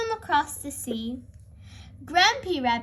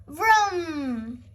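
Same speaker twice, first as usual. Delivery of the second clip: engine vroom. A narrator's reading. Hovercraft engine noise.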